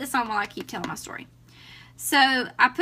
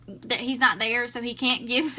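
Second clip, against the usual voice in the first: ordinary delivery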